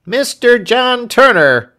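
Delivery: announced loudly and high; loud announcing cry; waiter's cry. Acted. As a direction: call out the visitor's name. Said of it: "cried the hotel waiter".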